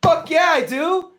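high, slow, laughing hype; hyped plug. Acted. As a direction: shout the plug gleefully. Hyped self-promo plug.

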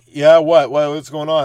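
put-on loud, cocky kid challenge. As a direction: brush off the adult, loudly. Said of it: "They're like".

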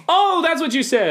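high, loud sudden understanding; high mock realization. Coworkers finally understanding the name, high loud realization. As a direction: squeal it like a penny dropping.

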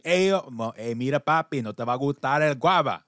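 put-on playful Spanish-accented quote. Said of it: "the Spaniards told me".

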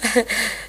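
short, soft nervous giggle; a little uneasy laugh. A narrator's reading. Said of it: "Ze lacht een beetje zenuwachtig". (zenuwachtig lachje)

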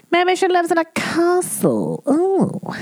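high put-on English lady voice; posh English voice. Shown as a demonstration. Host imagining the English fan in her castle, in a high posh voice. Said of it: "repeated in put-on voice".

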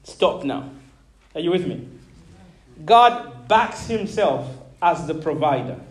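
ordinary delivery

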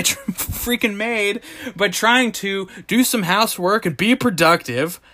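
usual speaking voice

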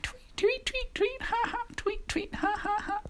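very high, twittering bird laughter; tiny birds tweeting and laughing at Toad; laughing robins. A narrator's reading. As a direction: chirp and cackle like mocking little birds. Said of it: "Toad heard laughter".

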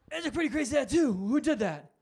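lightly hammed-up curiosity; acted astonished onlooker. Asking a child about his tattoo, acted astonishment.

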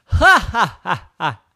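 staged ha-ha laugh, loud then easing; mocking laughter. Planet laughs at the lost star, mocking ha-ha. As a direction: laugh it out in separate beats. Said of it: "And the planet laughed".

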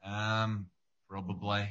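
softly voiced [name] drawl. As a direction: lightly mimic [name] saying probably. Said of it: "he always says the word probably".

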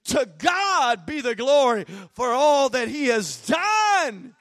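loud, full-force preaching. Real, not acted.

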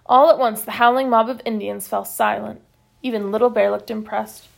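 usual speaking voice

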